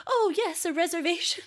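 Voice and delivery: high, hammed-up host greeting; mock restaurant host. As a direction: play a gushing host confirming a booking. Playing staff as restaurant hosts.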